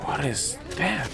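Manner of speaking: hushed. Real, not acted. Vlogger in a narrow shop aisle, hushed. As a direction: keep it hushed so the shop won't hear. Hushed talk inside a store.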